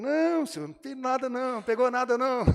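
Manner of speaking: a high, loud, caricatured reply; sheepish disciples. Acted. The disciples answer Jesus from the boat, high caricatured voices. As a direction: play the embarrassed fishermen calling back.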